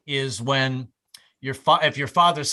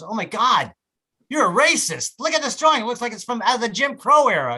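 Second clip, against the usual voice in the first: higher-pitched, acted-out offended customer; mock outrage; 6 semitones higher, 3 dB louder than usual. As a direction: play an appalled customer accusing the artist. Acting offended caricature customer.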